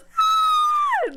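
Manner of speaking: very high, loud shriek; piercing mock scream of fright; imitated classroom scream. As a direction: scream like startled schoolkids at thunder. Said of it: "se oían los gritos".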